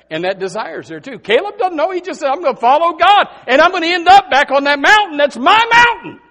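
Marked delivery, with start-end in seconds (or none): 1.2-6.2 high, loud exhortation; loud high call. Real, not acted. Preacher calling young lady and young man, loud high exhortation.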